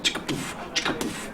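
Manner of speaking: soft, voiceless puffing sound effect; imitated muffled banging. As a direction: mimic padded thuds under your breath. Imitating doors banging on mattresses.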